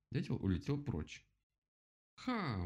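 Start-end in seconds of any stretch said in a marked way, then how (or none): 2.2-2.6 high crowing ha; triumphant exclamation. A narrator's reading. Rabbit gloats over the woodpecker, exclaiming. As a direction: let out a raised, gloating cry. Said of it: "воскликнул кролик".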